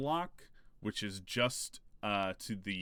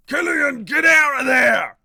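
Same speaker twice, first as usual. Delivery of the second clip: full-volume, high-pitched acted bellow; Vinnie Jones yell; 19 dB louder than usual. Game master as crewman warning Killian, yelling. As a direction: yell a warning at the top of your voice. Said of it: "voice that go, uh, that starts yelling".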